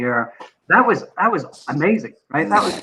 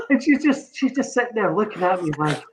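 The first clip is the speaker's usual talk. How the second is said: ordinary delivery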